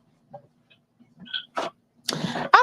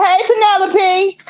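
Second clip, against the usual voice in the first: put-on high, slow sing-song call to a baby; lilting high greeting to a toddler; 10 semitones higher, slower than usual. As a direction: call out sweetly to the little one. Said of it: "Magdalene said".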